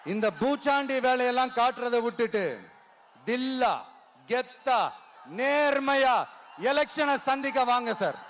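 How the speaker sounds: ringing political speech with long-held line endings; rally oratory. Real, not acted. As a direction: rouse a huge crowd, pausing for cheers. (மேடைப் பேச்சு, முழக்கமாக)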